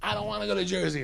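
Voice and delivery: high, drawn-up mock wail without volume; retold protest yell. Woman protesting the ride to Jersey, high mild mock yell. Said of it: "She's yelling".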